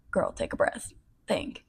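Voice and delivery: soft, voiceless aside to oneself; sigh, then whisper a reminder to yourself; under the breath, steadying yourself; whispered self-talk. Real, not acted. Coaching herself after losing words.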